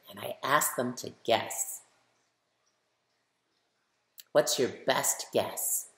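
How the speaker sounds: plain reading voice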